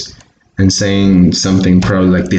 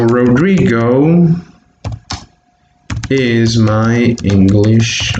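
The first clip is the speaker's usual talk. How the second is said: slow, spaced-out words while writing; teacher dictating as he writes; dictating slowly; slower than usual. Shown as a demonstration. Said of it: "write those examples".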